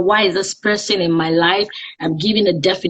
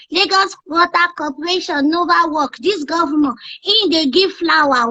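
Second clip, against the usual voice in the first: put-on high kid voice, complaining in broken English; a cheeky child grumbling about the governor; baby voice; 10 semitones higher than usual. Radio host in child voice mocking the governor. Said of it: "just use that baby voice".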